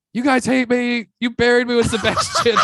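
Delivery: high, loud panicked yelling; freaking out. Retold or imitated. Bombing on stage, telling the crowd off in a high panic. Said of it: "I start freaking out".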